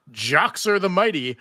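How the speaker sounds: ordinary delivery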